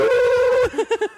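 high laugh. Real, not acted. Cracking up after the meme quote, squealing laughter. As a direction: let the laugh break up into a shriek.

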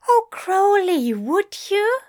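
high, loud, tearful relief; tearful gratitude. Acted. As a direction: gush thanks on the edge of tears. Grateful plea to Crowley.

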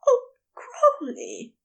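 high, slow breathless cry; breathless gasp. A narrator's reading. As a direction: gasp the name out, drawn out and high. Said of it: "Aziraphale gasped, breathless".

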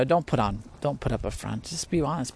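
usual speaking voice

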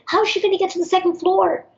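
high-pitched alarmed mimicry; retold panic. Woman worrying about the second floor, mimicked alarm.

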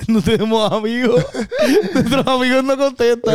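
high-pitched, laughing lament; laughing in mock self-pity; laughing complaint. Real, not acted. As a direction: crack up while complaining. Joking that nobody answers their calls.

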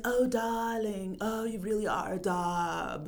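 put-on a light Mid-Atlantic Hepburn accent; posh old-Hollywood Hepburn accent, lightly done; Katharine Hepburn accent. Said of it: "Katharine Hepburn accent".

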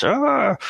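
sudden high yelp; mock scream from the trunk.

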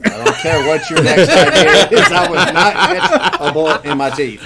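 loud, high, laughing protest; laughing refusal. Real, not acted. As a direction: laugh while refusing it loudly. Refusing, laughing, repeats louder.

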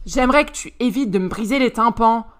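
râler plus fort, agacée; râlé. Acted. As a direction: grogner contre le vacarme. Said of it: "râla Crystal". (Crystal râle contre Alistair)

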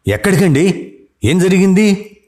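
high, panicked questions from the wife; anxious panic. Acted. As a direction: blurt it out in alarm, voice jumping up. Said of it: "కంగారుగా". (కంగారుగా, ఎత్తైన స్వరంతో)

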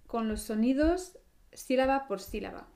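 usual speaking voice

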